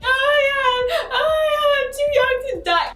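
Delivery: high, wailing mock sob, stretched out; hammed-up tearful child panic; mock crying panic. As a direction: wail it like a panicked little kid crying. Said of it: "I'm crying my eyes out".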